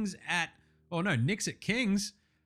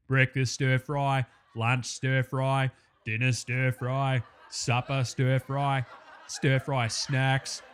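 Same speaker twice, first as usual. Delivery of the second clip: slow, lower, deliberate listing; 3 semitones lower, much slower than usual. Acted. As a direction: draw out each meal.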